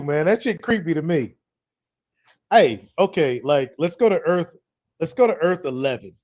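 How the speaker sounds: ordinary talk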